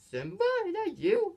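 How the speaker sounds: very high, amazed question; mock astonished Nala. Imitating Nala recognising her lost friend Simba, amazed. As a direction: act out disbelief at meeting an old friend. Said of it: "She's like".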